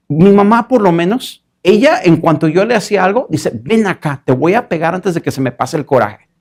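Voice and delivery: a hint of a mother losing her temper; hammed-up scolding mother; angry mother.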